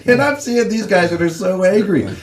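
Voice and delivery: high-pitched, laughing retelling. Real, not acted. Laughs telling of angry teammates.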